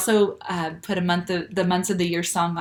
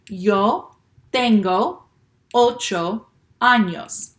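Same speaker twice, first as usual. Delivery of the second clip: very slow and a bit louder, for the class to follow; slow read-along; 2 semitones higher, 5 dB louder, much slower than usual. Shown as a demonstration. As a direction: draw each word out so kids can join. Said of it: "so let's read it together".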